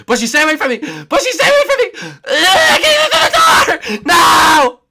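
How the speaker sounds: very high and loud shrieks; frantic high yelps of panic; panicked screaming. Real, not acted. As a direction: scream while running away. Fleeing from the seeker, panicking.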